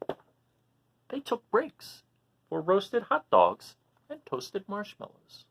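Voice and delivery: lively, high storybook voice; animated read-aloud for little listeners; reading to children. A narrator's reading. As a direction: read it out like a picture book for kids. Reading picture book to children.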